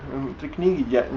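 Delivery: normal talking voice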